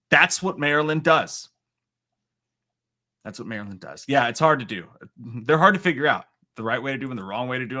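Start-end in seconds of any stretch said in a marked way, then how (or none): 0.1-1.5 loud, punchy declaration; mock proclamation. Streamer to viewers after news updates, loud mock proclamation. Slogan declared, then quietly repeated. 3.3-4.0 soft, low, offhand echo; quiet repeat. Real, not acted. Streamer echoing his own slogan, soft and low.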